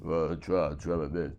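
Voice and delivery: ordinary delivery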